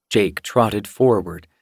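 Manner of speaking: usual speaking voice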